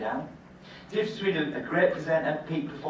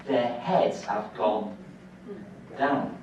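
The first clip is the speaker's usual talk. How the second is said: commentator voice; much slower than usual. Retold or imitated. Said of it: "the commentators say".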